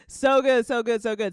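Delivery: gushing. Real, not acted. Host gushing to chat about the singing, loud.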